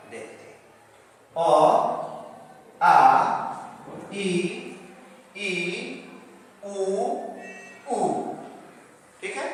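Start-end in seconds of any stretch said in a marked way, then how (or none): 1.3-8.0 isolated vowels, each held with a pause after; teacher modelling each vowel sound; model pronunciation. Shown as a demonstration. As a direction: sound out the Hindi vowels one by one. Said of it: "इसका सही उच्चारण यहाँ पर".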